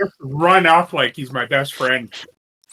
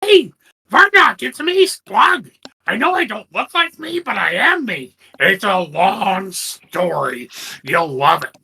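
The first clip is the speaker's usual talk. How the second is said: high, slow goblin shouting to stop; pleading goblin; 6 semitones higher than usual. Acted. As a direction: goblin Splug begs friends to wait. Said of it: "and I'm like".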